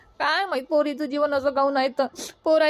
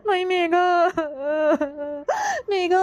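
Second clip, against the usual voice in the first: calling her daughter's name through sobs; a higher, broken cry of the name; 3 semitones higher than usual. Acted. Mother weeping as her daughter leaves home, crying out her name.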